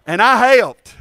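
a hint of a proud little girl, loud; little girl from the commercial. Quoting the Shake and Bake girl, lightly voiced. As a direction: chirp it like the kid in the ad. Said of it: "what did the little girl say".

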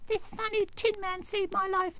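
very high little royal squeak; squeaky mouse queen. A narrator's reading. Mouse queen explaining her rescue, squeaky. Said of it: "in a squeaky little voice".

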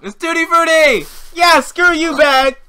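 high, loud relieved cheer; triumphant shout. Real, not acted. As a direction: gloat at the bag loudly. Relief at a good bean.

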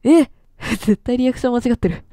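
ordinary talk

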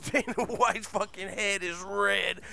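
normal talking voice

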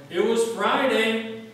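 loud, high, slow preacher's shout. Retold or imitated. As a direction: drag it out and shout to the rafters. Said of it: "and he shouted".

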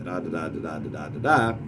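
steady da-DA rhythm, weak then strong; iambic pentameter. Shown as a demonstration. Teacher repeating the iambic line, every second "da" stressed. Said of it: "say, iambic pentameter".

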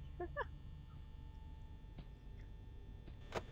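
high unvoiced nervous laugh; breathy nervous laugh. Real, not acted.

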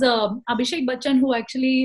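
ordinary talk, nothing marked

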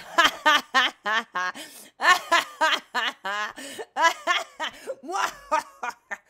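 long loud laughing fit; long cackle. Real, not acted. Streamer laughing at own joke, long loud cackle. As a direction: let the laugh roll out.

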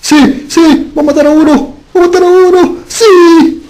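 very high, loud excited yelling; excited shriek. Real, not acted. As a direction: squeal with excitement. Kill about to happen.

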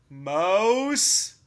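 loud questioning call. A narrator's reading. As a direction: call out into the room. Bear calls for hidden Mouse.

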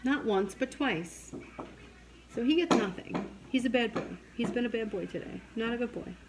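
ordinary talk, nothing marked

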